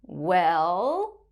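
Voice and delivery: "Well?" starting low and swooping up; drawn-out "Well?" dipping before it climbs; falling then rising tone. Shown as a demonstration. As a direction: say "Well?" like a Mandarin third tone. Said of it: "we use a similar tone".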